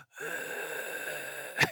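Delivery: breathy rasping gasp, no voice; a sick preacher gasping for air; raspy gasp. Shown as a demonstration. Pastor with flu straining to preach, raspy gasp. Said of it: "I had this raspy voice".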